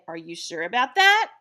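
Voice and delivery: "that" lifted in pitch as a doubting question; rising doubtful inflection. Shown as a demonstration. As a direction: question it, voice climbing on "that". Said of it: "when my voice goes up, it shows doubt".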